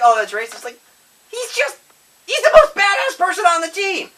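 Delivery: passionately defending a beloved robot; fired-up rant. Real, not acted. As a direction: get worked up and insist hard.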